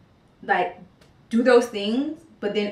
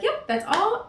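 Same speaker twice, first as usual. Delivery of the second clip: mock cheerful; 12 semitones higher than usual. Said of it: "it would sound great if I'm like".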